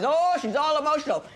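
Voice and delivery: high, whiny mock of a complaining man. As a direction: mimic a sulky man whining about her tears. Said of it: "Men always say".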